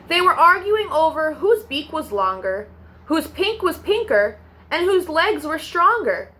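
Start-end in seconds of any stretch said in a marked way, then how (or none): none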